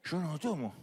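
imitación leve de un ebrio; niégalo como un borracho; borracho que lo niega. Acted. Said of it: "Cómo se manda a un ebrio". (borracho excusándose, apenas actuado)

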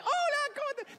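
saludo muy agudo, de alegría fingida; risa falsa de mamá. Retold or imitated. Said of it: "Mi mamá se reía así". (imitación chillona de la mamá recibiendo visitas)

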